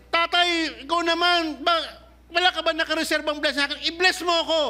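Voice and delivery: high, fast, whining plea to Dad; begging Esau. Acted. Esau begging his father for a blessing, high and fast. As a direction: beg like a son left out.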